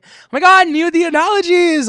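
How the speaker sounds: very loud, high-pitched outburst; excited self-hype. Real, not acted. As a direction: shriek it, amazed at your own cleverness. Hyping up her own analogy.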